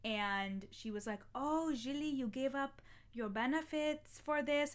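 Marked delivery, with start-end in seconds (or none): 1.3-4.7 higher-pitched, hammed-up German accent; terrible German accent. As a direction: imitate a German grandma with a bad accent. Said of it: "That's a terrible German accent".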